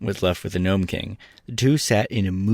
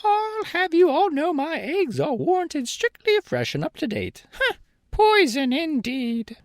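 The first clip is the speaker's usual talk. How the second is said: hen voice. A narrator's reading. Billina voiced.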